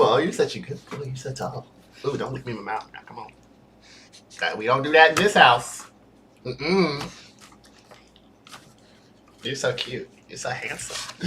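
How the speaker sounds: soft cooing to dog. Real, not acted. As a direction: murmur sweet things to the dog.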